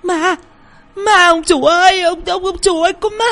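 high panicked yelling; screaming for help. A narrator's reading. Girl Thúy shouts to master about a ghost, panicked high cries. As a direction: shriek for the master in fright. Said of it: "miệng la oai oái".

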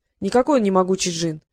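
ordinary delivery, nothing marked

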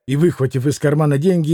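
usual speaking voice

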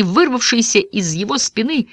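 usual speaking voice

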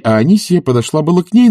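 usual speaking voice

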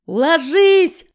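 loud, high shout; yelled warning. Acted. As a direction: bellow it at the crowd. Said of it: "проорал кто-то".

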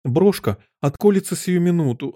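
high, fretful warning; anxious lament. A narrator's reading. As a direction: fret aloud as if it hurts already. Queen moaning in alarm. (тревожно, высоким голосом)

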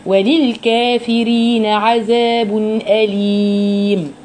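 slow chanted recitation; Quran recitation. Shown as a demonstration. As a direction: recite it drawn out with full vowels. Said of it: "اتم الحركه".

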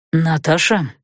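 insinuating. A narrator's reading. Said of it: "голос некроманта стал вкрадчивым".

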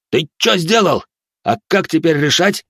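high-pitched, incredulous questioning; astonished outburst. Acted. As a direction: demand an answer in startled disbelief. Astonished man confronts the narrator.

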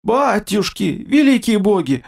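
put-on old man awestruck before the king, choked voice; choked babble. A narrator's reading. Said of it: "пролепетал задушенным голосом".

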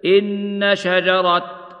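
slow إن شجرة ending on a clear t; pausal form with ta. Shown as a demonstration. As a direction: stop on شجرة with the t sounded. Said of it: "ويوقف عليها بالتاء ... هذا الموضع هكذا".

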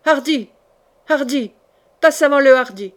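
restrained narrated war shout; rally fighters, building in volume; battle cry. A narrator's reading. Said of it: "à pleine voix comme à la bataille, il cria".

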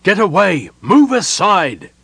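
loud, slow, firm commands; firm order. A narrator's reading. Gerard clearing the crowd with firm loud orders. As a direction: order them aside with authority. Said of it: "Gerard ordered in firm tones".

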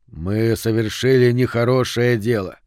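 ordinary delivery, nothing marked

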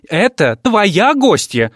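slow, high, loud shriek. Acted. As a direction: shriek it back at him. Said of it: "завизжала Николетта".

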